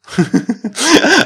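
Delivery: high burst of laughter; loud laugh. Real, not acted. Laughing at clerk. (громкий смех)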